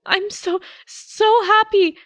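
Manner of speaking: put-on high, loud, slow tearful boy; slow high boy sobbing out happy words; breaking into sobs. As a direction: let him fall apart crying with joy. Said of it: "breaks down into sobs".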